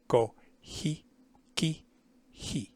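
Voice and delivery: ordinary delivery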